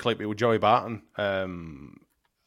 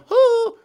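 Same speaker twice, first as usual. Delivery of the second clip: mock computer hoot; 9 dB louder than usual. Imitating computer assistant popping up.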